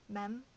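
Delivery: "Mem" with a short e, as a question; pronunciation option. Shown as a demonstration. Vlogger unsure how it is pronounced, "Mem" with short e. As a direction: try "Mem" out, rhyming with hem. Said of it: "How do you pronounce it?".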